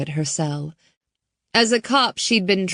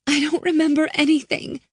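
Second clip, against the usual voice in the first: put-on much higher, fast frightened girl; scared girl; 10 semitones higher, faster than usual. Girl answering Riley, high and fast. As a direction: blurt it out helplessly. Hannah's voice.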